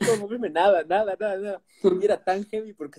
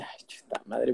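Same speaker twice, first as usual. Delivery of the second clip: whisper; 5 semitones lower, 9 dB quieter, slower than usual. Retold or imitated. Sister scolds during sleep paralysis.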